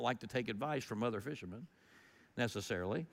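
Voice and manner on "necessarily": ordinary talk, nothing marked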